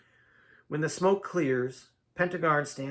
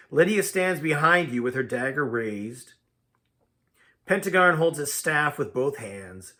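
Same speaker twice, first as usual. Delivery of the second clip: plain reading voice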